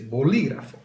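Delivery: bolígrafo with the peak on LI; stress on LI. Shown as a demonstration. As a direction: say bolígrafo as bo-LI-gra-fo. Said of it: "underline that syllable".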